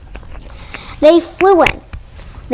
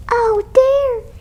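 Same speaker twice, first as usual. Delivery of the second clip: crying; 10 semitones higher than usual. Acted. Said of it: "he cried".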